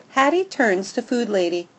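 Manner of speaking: plain reading voice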